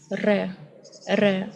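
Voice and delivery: RE with a trilled tongue-tip r; rolled R. Shown as a demonstration. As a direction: roll the r in "re", then a short eh. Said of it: "roll this letter inside your mouth".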